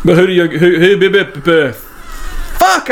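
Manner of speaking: stuttering into a jumble of broken syllables; tongue-tied stumble. Real, not acted. Host fumbling his question without alcohol, tongue-tied. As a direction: trip over the words and give up. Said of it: "I could do this so much better with alcohol".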